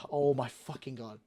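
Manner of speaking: low, hushed exasperation; exasperated muttering. Real, not acted. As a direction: sigh it out under your breath. Exasperated, says he is losing it.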